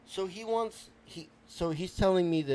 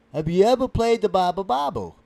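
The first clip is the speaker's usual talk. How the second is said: loud, insistent question; exasperated repeat; 9 dB louder than usual. Acted. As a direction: belt out the question to be understood. Repeats question to baffled host.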